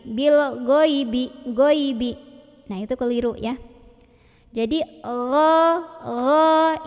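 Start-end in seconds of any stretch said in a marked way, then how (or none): 0.1-2.1 "ghaibi" with a plain hard g as in "go"; wrong hard g. Shown as a demonstration. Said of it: "gha yang dibaca dengan G". 5.0-6.8 slow "gha" voiced from the top of the throat; throaty gha. Shown as a demonstration. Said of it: "ini dari tenggorokan paling luar".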